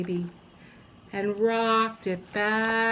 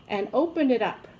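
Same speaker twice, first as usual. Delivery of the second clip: ordinary delivery, nothing marked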